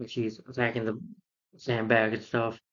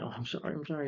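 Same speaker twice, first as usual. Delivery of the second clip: retold apology, much softer; hushed apology; 3 semitones higher, 9 dB quieter, faster than usual. Elektra realizes she stabbed Matt, hushed apology. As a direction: say sorry softly, rising on the repeat.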